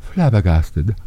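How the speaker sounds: low, fading to a whisper; mock flabbergasted. Host as shocked joke caller, low fading mock voice. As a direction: let the voice sink away into a whisper.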